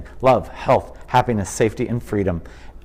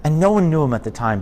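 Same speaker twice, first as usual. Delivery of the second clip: usual speaking voice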